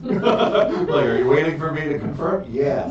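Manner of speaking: loud, high burst through laughter; amused, laughing. Real, not acted. Game master realizing players await confirmation, laughing outburst. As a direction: blurt it out laughing, real amusement.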